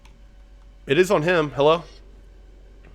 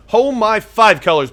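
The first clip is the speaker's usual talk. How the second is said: raised, higher exclaim of disbelief; louder second take; 5 semitones higher, 5 dB louder than usual. Real, not acted. Gamer exclaiming at the opponent's five-colour deck. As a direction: call it out, surprised.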